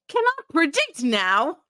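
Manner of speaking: loud readout. Acted. Reading a Magic Eight Ball answer to a co-host, loud and high.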